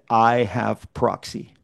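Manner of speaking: low, slow and firm; quiet, final, unmoving. Retold or imitated. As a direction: lean in and lay down the law. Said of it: "in as firm a way as he ever said".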